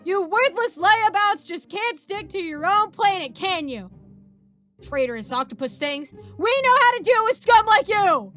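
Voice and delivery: high, loud, slow scornful tirade; angry shout. Acted. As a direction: spit contempt at the alien. Soldier berates alien prisoner.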